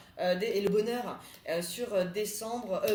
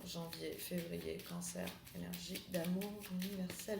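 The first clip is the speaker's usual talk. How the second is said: hushed invocation; 2 semitones lower, 9 dB quieter than usual. Acted. Tarot invocation for cancers.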